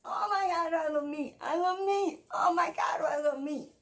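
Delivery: high, loud, repeated squeal of delight; hammed-up ecstatic exclaiming; gushing excitement. Gushing exaggeratedly about meat.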